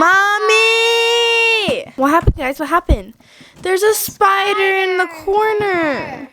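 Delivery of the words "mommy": high call for mommy. Acted. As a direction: yell for mommy in fright. Calling mom for help.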